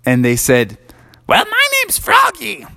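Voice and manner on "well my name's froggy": much higher little frog voice; Froggy, a small, high frog voice. Acted. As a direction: squeak it out like a tiny frog. Froggy introduces himself.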